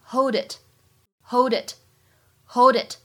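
"hold it" slow, d running into it; linked hold it. Shown as a demonstration. Teacher showing the linking in "hold it", slow. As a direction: run "hold it" together, slowly. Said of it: "前两者可以做连读".

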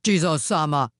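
loud, lowish ogre call; booming demand for attention; crying out. A narrator's reading. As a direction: call out as the wicked Oni. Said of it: "cried the wicked Oni".

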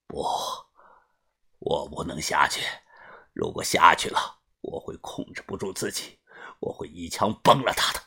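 低沉轻声，一句一顿; 强忍着情绪低声说. A narrator's reading. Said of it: "哭了". (流泪老人压抑的低语)

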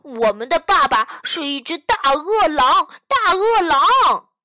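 cheeky cub shouting, slowing on the last words; wolf cub yells a boast at the teacher; rude shout. A narrator's reading. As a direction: bellow the big bad wolf boast. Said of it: "粗鲁地大叫".

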